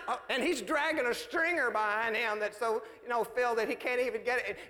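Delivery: normal talking voice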